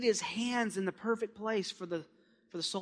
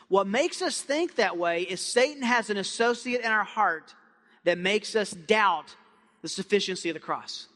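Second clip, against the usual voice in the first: usual speaking voice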